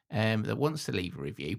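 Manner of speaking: ordinary talk, nothing marked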